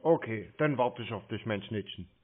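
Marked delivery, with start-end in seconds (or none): none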